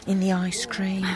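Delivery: hushed, secretive whisper. Acted. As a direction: lean in and whisper the gossip. Hushed gossip at village show.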